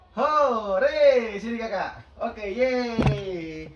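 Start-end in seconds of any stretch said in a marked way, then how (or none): 0.1-1.4 cheering. Acted. Bilang hore.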